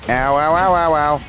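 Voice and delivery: playful pained yelps; pained ow cries. Real, not acted. Taking hits in a fight.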